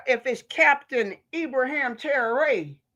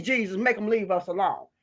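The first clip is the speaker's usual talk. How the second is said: ordinary talk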